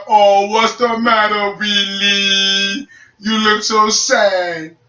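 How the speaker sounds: slow, drawn-out teasing; imitating a taunting older brother; mock sympathy. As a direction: needle someone with fake pity. Brother teasing him after work.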